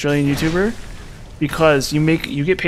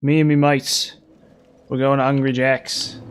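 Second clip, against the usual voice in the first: mock Australian. Streamer returns to the Aussie bit, mock Australian accent. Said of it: "I can do an Australian".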